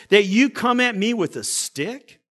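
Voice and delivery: ordinary delivery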